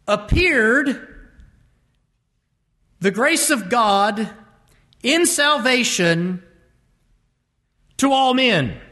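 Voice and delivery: slow, deliberate, each chunk set apart; awkward literal word order. Shown as a demonstration. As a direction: read the odd Greek word order haltingly. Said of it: "how weird would it sound if we said".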